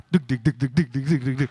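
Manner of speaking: rapid thudding "duk" sounds; imitated flat-tire rattle; staccato clunks of a wheel on its rim; rim clunking noise. Imitates driving on the bare rim.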